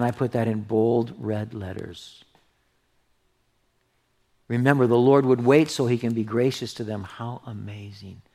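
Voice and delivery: ordinary talk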